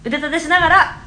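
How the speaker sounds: loud barking drill instructor; drill sergeant instructor. Acted. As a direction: order them around like a coach. Drill instructor teacher role. (鬼教官の怒鳴り声)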